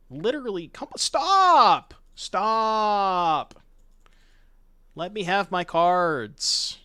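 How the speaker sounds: frustrated yelling; calm turning angry. Real, not acted. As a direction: stretch it out, yelling stop. Opponent keeps disrupting him.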